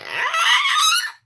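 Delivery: high shrieking yell of frustration; exasperated scream. Retold or imitated. Husband riles wife up, she shrieks in frustration. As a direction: let out a wild mock scream. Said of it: "get me like".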